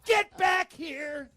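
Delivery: high, loud furious yell; retold girlfriend yelling from the yard; angry yell. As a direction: scream at him to come back.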